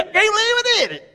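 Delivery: high-pitched cry of disbelief; mock disbelief. Preacher acting out a sinner for the congregation, mock disbelief. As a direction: play amazed at your own misdeed. Acts out someone amazed at own deed.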